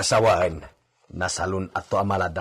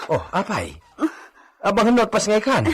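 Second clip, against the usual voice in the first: alarmed; 13 semitones higher, faster than usual. Acted. Mang Benito panics as the senyorita goes into labour, alarmed. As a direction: blurt it out in a panic.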